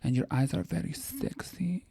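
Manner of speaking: slow breathy whisper close to the ear; creepy whisper. Retold or imitated. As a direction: whisper it, leaning in too close. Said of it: "she got really close to me and she went".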